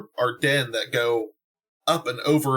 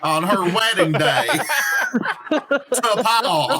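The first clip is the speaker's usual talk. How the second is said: shouting through laughter; laughing loud and high while toasting; real laughter bursting through the toast; 10 semitones higher, 5 dB louder, much slower than usual. Real, not acted.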